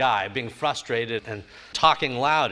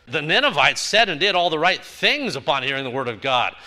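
usual speaking voice

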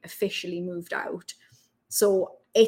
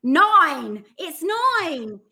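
loud, high, mock Essex "noin"; exaggerated Essex accent; 7 semitones higher, 8 dB louder, much slower than usual. Bar worker forced to switch, exaggerated Essex accent. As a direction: overdo the Essex vowel to be understood. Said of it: "I'd have to go".

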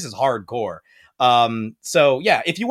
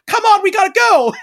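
high, loud urgent call; urgent girl; 9 dB louder than usual. Retold or imitated. Blonde girl freeing Dorothy from restraints, high urgent call. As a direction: hurry her out, breathless urgency. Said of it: "She's like".

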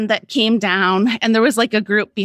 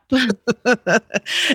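rising laugh; 2 semitones higher than usual. Real, not acted. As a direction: let a little laugh rise out of you. Laughing at her own story.

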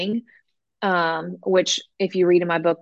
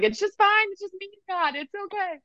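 high, breaking voice trailing softer; retold teary reassurance, high and fading; tearful self-talk; 14 semitones higher, 4 dB quieter than usual. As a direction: reassure yourself through tears, pitched way up. Retells crying while running, voicing herself.

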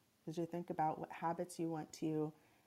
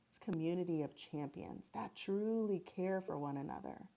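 sleep story narrator. Acted. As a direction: gently guide them into calm. Leads a guided meditation.

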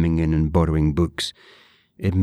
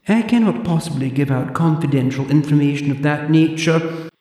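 put-on high, prim old librarian voice; haughty zombie librarian, clipped and proper; librarian Deirdre; 11 semitones higher, 4 dB louder than usual. Deirdre lectures the borrower in a high prim voice.

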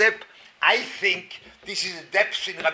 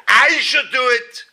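mock indignant complaint; 6 semitones higher, 7 dB louder than usual. Mocking the reluctant husband's complaint.

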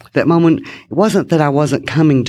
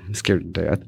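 quieter echo; 7 semitones lower, 8 dB quieter than usual. Real, not acted. Remembering her first teaching days, soft low echo. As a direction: lower and much softer repeat. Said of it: "Oh, scared to death".

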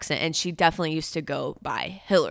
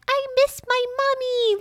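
put-on whiny little kid. Grown woman with her parents turning rugrat, mock childish whine. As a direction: play up a juvenile whine for mommy. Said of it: "I become a literal rugrat".

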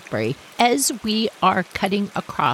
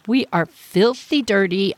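ordinary talk, nothing marked

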